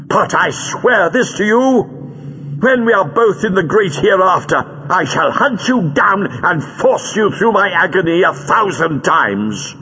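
high-pitched, scornful oath of vengeance; forceful anger. A narrator's reading. Captive woman vowing revenge on her captor, forceful anger. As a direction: swear revenge with mounting force. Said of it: "Recoiling from the force of her anger".

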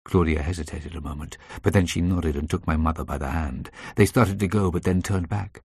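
plain reading voice

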